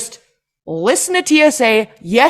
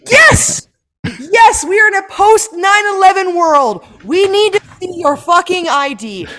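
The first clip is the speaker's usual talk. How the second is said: exasperated shout; 6 semitones higher, 4 dB louder than usual. Retold or imitated. Ranting as TSA agent.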